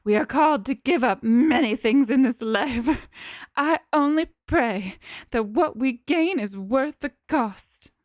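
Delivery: a hint of tears held back, solemn; strained, sorrowful plea. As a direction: speak with strain, heavy with doubt. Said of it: "The strain in her voice is evident".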